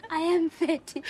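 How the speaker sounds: high giggly put-on voice; mock reply. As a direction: say it through a laugh as a joke. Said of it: "then I would always have to say".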